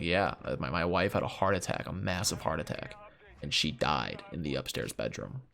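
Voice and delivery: mildly sombre, fading low; quiet, heavy explanation; sombre widower. Retold or imitated. Widower telling grandpa about the heart attack, quiet and sombre.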